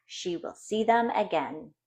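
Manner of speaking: usual speaking voice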